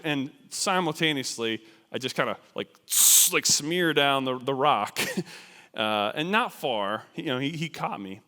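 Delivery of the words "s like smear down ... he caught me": plain reading voice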